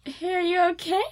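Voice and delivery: high concerned check-in; concerned call. Retold or imitated. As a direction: call out gently worried. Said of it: "he was just like".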